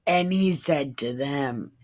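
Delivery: normal talking voice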